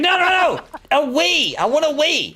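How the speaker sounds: high, loud mock-panicked protest; frantic correction. Visitor correcting old man who heard weed, frantic protest. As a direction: act out desperately clearing up a misunderstanding.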